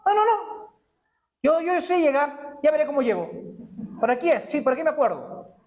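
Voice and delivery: high, brushing off the wife's advice; stubborn husband. Retold or imitated. Preacher mimics a husband refusing directions, high and dismissive. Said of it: "¿Y qué decimos los hombres?".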